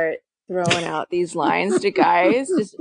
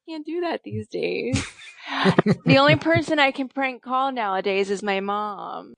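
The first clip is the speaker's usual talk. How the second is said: normal talking voice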